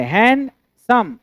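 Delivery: "हैंडसम" slowly split as hand, some; syllable by syllable. Shown as a demonstration. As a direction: stretch "हैंडसम" with a gap in the middle. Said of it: "दो syllable है". ("हैंडसम" को धीरे से दो हिस्सों में तोड़कर बोलें)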